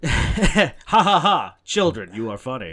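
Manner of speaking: loud, slow mock guffaw; hammed-up amusement. Co-host answering the millennial joke remark, hammed-up jolly voice. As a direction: fake a big patronizing laugh.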